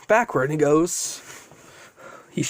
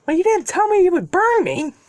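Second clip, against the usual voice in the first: high, pushed, indignant comeback; protesting young hero; 13 semitones higher, 3 dB louder than usual. Acted. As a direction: protest in a much higher character voice. Deku arguing back in story.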